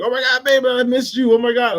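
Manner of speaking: put-on high, loud, gushing greeting to baby; excited squeal. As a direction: squeal it like you're thrilled to see her. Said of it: "be like".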